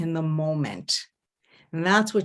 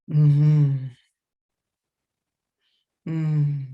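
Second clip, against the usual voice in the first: long, low, full "mm-hmm"; deep huddle hum; 4 semitones lower, 4 dB louder than usual. Shown as a demonstration. Coach showing couples falling into it, low humming. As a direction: let the hum sink low and linger.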